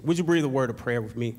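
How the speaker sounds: soft, earnest prayer voice over music; hushed heartfelt pastor's prayer. Acted. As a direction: pray quietly and sincerely before the sermon. Leads congregation in prayer.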